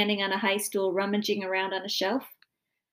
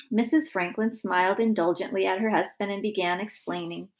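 usual speaking voice

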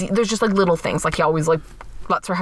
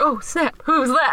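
excited; 7 semitones higher, slower than usual. Retold or imitated. Characters react in excitement.

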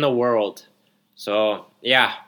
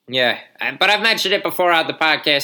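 ordinary delivery

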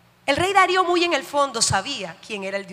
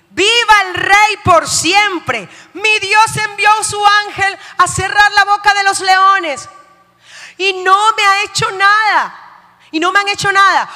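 Daniel answering the king from the den; triumphant shout; 6 semitones higher, 7 dB louder than usual. Acted. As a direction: ring it out from the pit.

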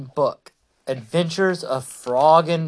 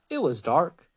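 plain reading voice

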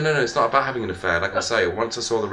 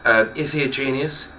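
ordinary delivery

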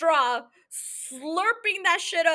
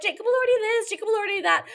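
mocking fans; 8 semitones higher, faster than usual. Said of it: "Y'all are like".

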